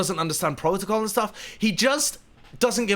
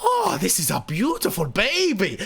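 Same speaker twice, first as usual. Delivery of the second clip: put-on enamored Latvian-accented cooing; Miralyn's Latvian accent; 2 semitones higher than usual. Latvian guest stroking the dashboard, adoring accented coo. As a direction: gush over it in a Latvian accent. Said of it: "this high tone and in this amazing accent".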